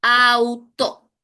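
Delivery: syllable demo; syllable split. Shown as a demonstration. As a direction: split Auto into its two syllables. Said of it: "Cuatro sílabas".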